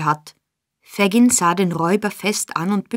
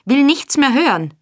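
higher, louder furious yell; raging; 5 semitones higher, 5 dB louder than usual. A narrator's reading. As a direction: refuse to hear another word. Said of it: "tobte Sykes".

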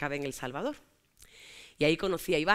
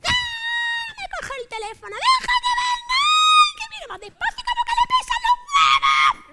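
put-on shrieking high, slow and loud like a jealous boyfriend; 8 dB louder, slower than usual. Jealous boyfriend screeching when he grabs her phone, shrill high yell. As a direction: screech it out, dragging each word high and loud.

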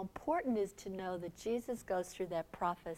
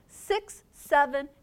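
ordinary talk

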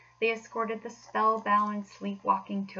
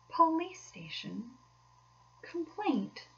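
dreamy murmur; 10 semitones higher, slower than usual. A narrator's reading. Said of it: "murmured Toad dreamily".

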